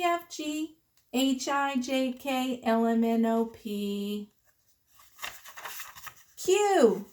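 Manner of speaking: ordinary delivery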